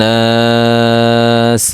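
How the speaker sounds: নাস with the long aa held for several beats; drawn-out naas. Shown as a demonstration. Quran teacher stretching the vowel when pausing at the end. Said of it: "ওয়াকফ হইলে মদ্দে আরজি".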